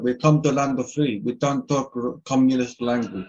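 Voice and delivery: put-on loud Russian immigrant accent; broken English in a heavy Russian accent. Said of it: "He does that shit good".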